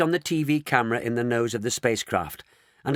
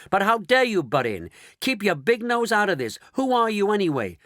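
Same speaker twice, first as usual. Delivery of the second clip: indignant; 9 semitones higher, 3 dB louder than usual. Acted. Shanks indignant at intruder.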